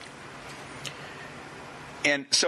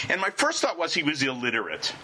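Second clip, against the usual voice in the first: ordinary delivery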